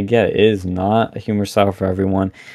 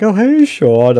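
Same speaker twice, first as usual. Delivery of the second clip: high, loud imitated greeting; mock excited recognition; 11 semitones higher, 5 dB louder, slower than usual. Protester spotting the speaker outside, imitated excited call. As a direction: call out like you spotted someone.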